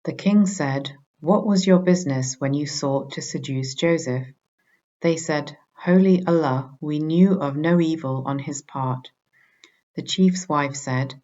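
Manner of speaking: usual speaking voice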